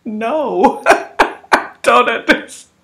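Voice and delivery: high, loud and slow, calling across a distance; shouting back with a laugh. Retold or imitated. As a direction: yell back with a laugh to someone far away.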